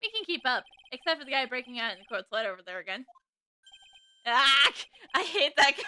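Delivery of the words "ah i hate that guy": mock cry of hatred; mock hate. Real, not acted. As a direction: fake loud annoyance. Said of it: "I like him".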